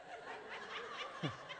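soft unvoiced laugh; quiet wheeze of a laugh; breathy chuckle. Real, not acted. Comedian laughing softly at the grocery bill.

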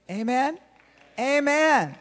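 calling amen. Real, not acted.

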